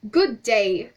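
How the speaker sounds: loud hearty greeting; booming hello; cried out. Acted. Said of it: "cried the man".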